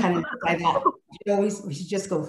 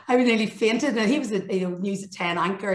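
ordinary talk

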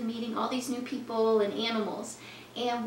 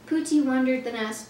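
plain reading voice

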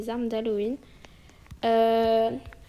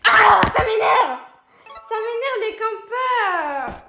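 high, loud, drawn-out complaint; frustrated outburst; 10 semitones higher, 5 dB louder, slower than usual. Real, not acted. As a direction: let the frustration burst out, slowly. Annoyed at campers. (agacée contre les campeurs, râle fort et aigu)

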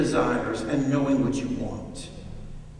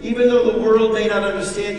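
usual speaking voice

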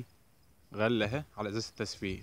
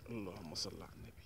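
breathless whispered blessing under the breath; tense hushed murmur while landing a fish; whispered prayer; 3 semitones lower, 15 dB quieter than usual. Real, not acted. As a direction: whisper it to yourself. Praying while reeling fish.

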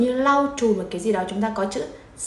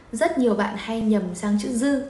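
normal talking voice

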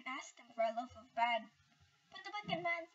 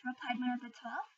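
ordinary delivery, nothing marked

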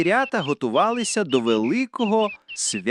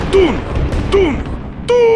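loud, high hooted thuds; big echoing "toong" impacts; booming stomp noise; 6 dB louder than usual. A narrator's reading. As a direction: boom out each thud separately, last one drawn out. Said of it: "із глибини лісу пролунало".

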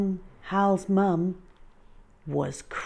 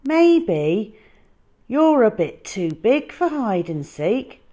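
a hint of a gentle teasing boy; lightly voiced boy; 7 semitones higher, 11 dB louder than usual. Hal telling the dinosaur gently, lightly voiced. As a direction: suggest it kindly. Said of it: "said Hal".

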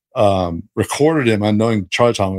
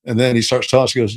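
usual speaking voice

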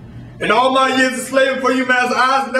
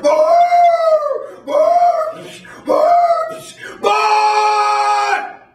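dog barks; much slower than usual. Acted. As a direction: bark like a sick old bloodhound. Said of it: "the old sick barks started".

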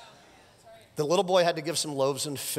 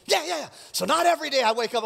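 ordinary talk, nothing marked

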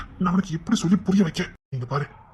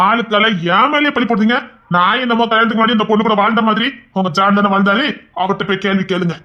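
angry retort; 5 semitones higher, 5 dB louder than usual. Acted. Husband accused, shouts back.

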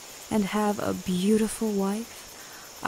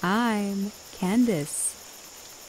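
very slow, drawn-out self-introduction; slow reply; much slower than usual. Acted. Witch slowly telling the frightened man her name.

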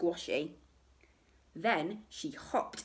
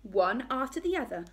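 ordinary delivery, nothing marked

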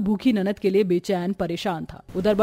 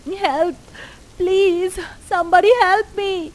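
injured woman calling out after the crash; desperate cry for help; 9 semitones higher, much slower than usual. Acted. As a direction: scream for help in the storm.

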